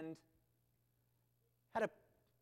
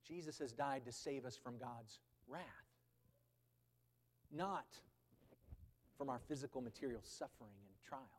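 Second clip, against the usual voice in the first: soft, lower sermon restatement; quiet; 4 semitones lower, 8 dB quieter than usual. Acted. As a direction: lower and much softer, drawn back. Quiet restatement after preaching.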